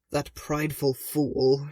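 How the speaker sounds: harsh voice from weeping; rasped. Acted. Lightly voiced sister rasping through weeping. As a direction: rasp it through tears. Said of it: "she rasped".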